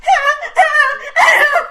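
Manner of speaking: high, loud wailing half laugh half sob; laugh-cry wail. Retold or imitated. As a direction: burst into a shrieking laugh-cry. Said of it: "and I'm like".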